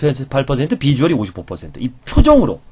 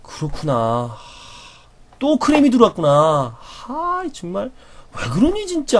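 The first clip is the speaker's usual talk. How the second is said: shared sorrow; 9 semitones higher, slower than usual. Shown as a demonstration. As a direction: feel their bad luck with them. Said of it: "이렇게 같이 표정을 지어줘야".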